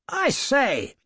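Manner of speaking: put-on high, indignant young gentleman's cry; a startled toff crying out in protest; aggrieved cry. A narrator's reading. Motorist to the masked driver, an aggrieved high cry. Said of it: "cried an aggrieved voice".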